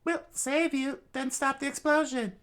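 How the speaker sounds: put-on high, eager young voice; high and urgent; high character voice.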